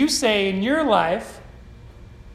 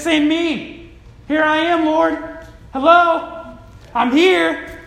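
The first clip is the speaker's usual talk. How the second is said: loud, raised eager volunteering; high, forceful acted plea; calling out; 4 semitones higher, 5 dB louder, slower than usual. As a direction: call out to be picked. Preacher acts Isaiah volunteering to God.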